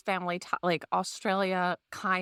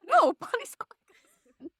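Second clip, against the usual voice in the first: high, loud and slow through laughter; retelling a mix-up while laughing; 3 dB louder, slower than usual. Retold or imitated. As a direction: squeal it out while cracking up. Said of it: "She's like".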